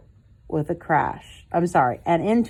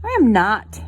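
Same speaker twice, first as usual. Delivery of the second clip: protesting; 5 semitones higher, 5 dB louder than usual. A narrator's reading. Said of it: "protested Brother".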